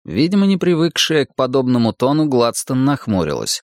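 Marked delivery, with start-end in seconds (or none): none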